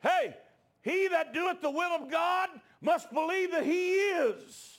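high, slow shouted declaration; loud pulpit shout, words stretched out; full-force preaching. Real, not acted. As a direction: cry out the truth to the congregation.

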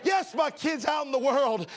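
loud, rising preacher's cadence; preaching at full force. Acted. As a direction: preach it out to the congregation.

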